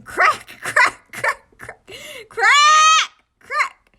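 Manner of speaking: very high, very loud shrieks; furious screaming. Acted. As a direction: scream each one at the egg. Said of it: "He furiously beat the egg".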